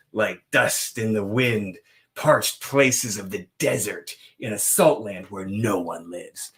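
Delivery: slow, weighty, savoring each word; dramatic gothic reading. Shown as a demonstration. Rereading the verse to show its gothic gloom, slow and heavy. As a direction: read it like dark gothic poetry. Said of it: "This is some gothic speak".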